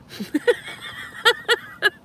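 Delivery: airy, high squeal of laughter; breathless high laugh. Real, not acted. Laughs at crude chat joke.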